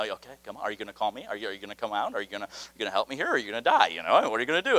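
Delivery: low, very soft offhand muttering; waiting Jesus. Acted. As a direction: murmur low and quick, idly waiting. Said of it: "it's like Jesus has been sitting there waiting".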